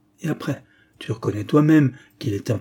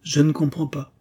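usual speaking voice

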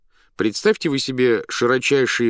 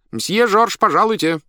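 high, loud shout from offstage; calling from behind a door; 12 semitones higher, 6 dB louder than usual. A narrator's reading. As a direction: call him on stage from behind the door. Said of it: "крикнул кто-то за дверью". (громкий высокий окрик из-за двери)

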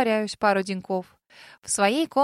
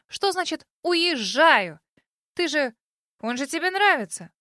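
louder, higher accusing outburst; 5 semitones higher, 3 dB louder than usual. A narrator's reading. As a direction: blurt it out as an accusation. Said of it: "обвиняюще выпалила Касси". (обвиняюще)

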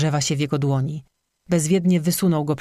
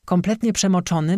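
ordinary delivery

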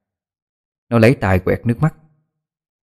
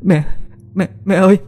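put-on high childlike cry; 10 semitones higher than usual. Daughter crying out to mother.

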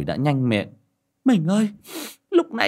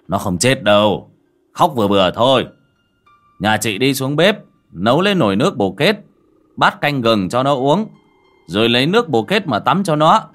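loud, raised voice giving orders; calling out; 4 semitones higher, 6 dB louder than usual. A narrator's reading. Man on the porch instructing the mother, calling inside loudly. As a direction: call it into the house, brisk and firm. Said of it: "ngồi ngoài hiên nói vọng vào".

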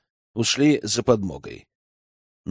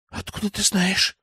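put-on higher, hushed girl's voice, startled; frightened question; 7 semitones higher, 4 dB quieter than usual. A narrator's reading. Exposed girl asks elder how he found out, frightened. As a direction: ask it with understated fear. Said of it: "спросила она испуганно".

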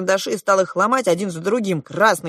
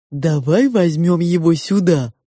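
slow, drawn-out sow voice; coaxing pig; 6 dB louder than usual. A narrator's reading. The pig suggests fetching the parrot, coaxing. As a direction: coax slowly, a little louder.

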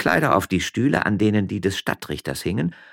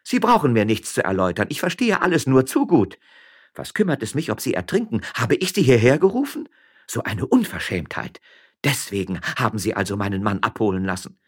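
high, scornful woman's voice; offended, accusing, pitched up; indignant miller's wife; 5 semitones higher than usual. Acted. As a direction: cut him off with rising outrage.

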